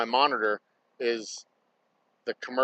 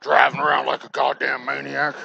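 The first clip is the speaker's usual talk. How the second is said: put-on louder, cranky old man grumble; grumpy old man voice; 6 dB louder than usual. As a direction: play it up like a crotchety old geezer. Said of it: "I sound like an old man".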